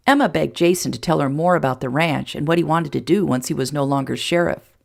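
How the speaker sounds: ordinary delivery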